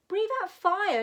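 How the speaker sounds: high, loud correction. Acted. Dragon teacher correcting a pupil's snow, loud. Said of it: "said Madame Dragon".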